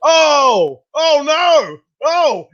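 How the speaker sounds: hammed-up group gasp; shocked cries. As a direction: cry out as he grabs the Reaper paste. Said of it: "we're like".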